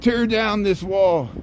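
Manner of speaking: a slow, lightly voiced presidential proclamation; a hint of a president declaiming, taken slowly; Ronald Reagan. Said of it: "And then Ronald Reagan said".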